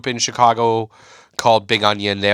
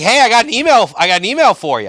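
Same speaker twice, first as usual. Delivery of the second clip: higher, louder teasing hype; mock excited manager; 10 semitones higher, 5 dB louder than usual. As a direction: dangle it like big news. Manager hyping up a small gig.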